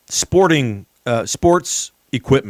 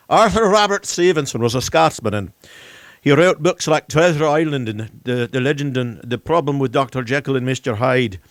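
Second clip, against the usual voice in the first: a lightly put-on, hammy Scottish accent; a poor imitation of a Scottish accent; terrible Scottish accent. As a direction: do a bad, half-hearted Scottish accent.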